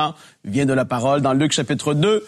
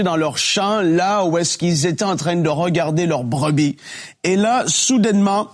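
dramatic scripture reading; 5 semitones higher than usual. Acted. Expressive Bible reading aloud.

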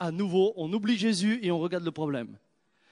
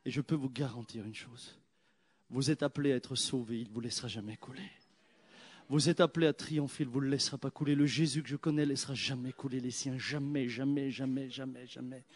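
much softer, lower, insistent; hushed, lower, repeated reassurance; quiet assurance; 5 semitones lower, 6 dB quieter than usual. Acted. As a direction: promise it softly, leaning in.

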